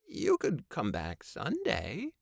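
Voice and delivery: high, slow, timid; Babe voice. A narrator's reading. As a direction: offer it timidly, slowly.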